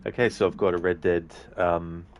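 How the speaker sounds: ordinary talk, nothing marked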